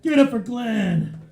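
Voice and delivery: big loud shout to the crowd; loud stage call-out; calling for applause. Real, not acted. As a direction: whip up a hand for the host. Comedian calls for applause.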